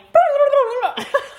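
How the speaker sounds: loud, high burble of nonsense; babbling noise. Shown as a demonstration. Showing how she went off on a tangent, silly babble. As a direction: blurt a silly rolling gibberish noise. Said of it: "but then I went like".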